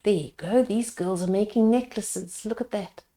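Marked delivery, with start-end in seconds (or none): none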